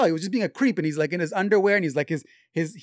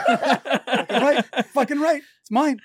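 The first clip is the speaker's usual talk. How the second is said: laughing triumphant; 9 semitones higher, 5 dB louder, slower than usual. Real, not acted. Laughing triumphant claim in banter.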